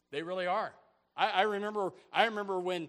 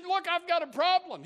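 higher, louder acted plea; agitated client; 11 semitones higher, 7 dB louder than usual. Client confronting his new attorney, mildly agitated and high. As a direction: play a client pressing his problem, pitched up.

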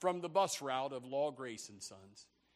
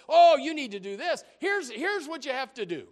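eager advice; 6 semitones higher, 10 dB louder than usual. Acted. Office staff eagerly advising.

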